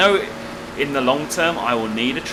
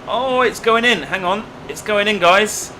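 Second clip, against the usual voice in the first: excited; 9 semitones higher, 6 dB louder than usual. Real, not acted. Harvester grain finally goes in.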